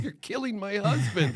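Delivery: high, slow, pleading wife's voice; exasperated wife. Retold or imitated. Wife scolding the filmmaker over her exhausted husband, high and slow. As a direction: draw it out high like a fed-up wife. Partner's wife glaring at him.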